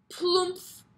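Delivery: loud high thud; plumph sound effect. Acted. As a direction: land it with a bump.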